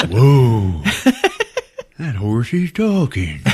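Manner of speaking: slow, wide-eyed creature wonder; amazed merman. Retold or imitated. Merman watching TV for the first time, slow amazement. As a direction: marvel slowly at the talking horse.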